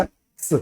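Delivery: एक्स as the voiceless ks at the end of बॉक्स; final ks sound. Shown as a demonstration. Teacher pointing out the ks at the end of बॉक्स, voiceless. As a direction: hiss एक्स softly as ks. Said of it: "last में बोला जा रहा है ना".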